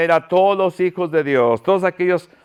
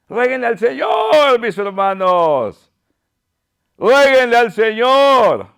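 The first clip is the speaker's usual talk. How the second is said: high, ringing preacher's call; fervent exhortation; 5 semitones higher than usual. Real, not acted. Preacher urging his brothers to beg the Lord, fervent call. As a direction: call out to the whole congregation.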